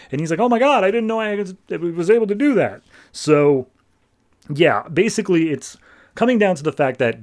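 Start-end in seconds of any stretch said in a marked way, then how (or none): 0.4-2.8 high, fast mock gasp of disbelief; a hammed-up startled reply; shocked. Shocked at his own deed.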